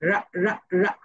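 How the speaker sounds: রা repeated with rolled r; letter sound ra. Shown as a demonstration. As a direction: drill "রা" for students. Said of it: "আর sounds like রা".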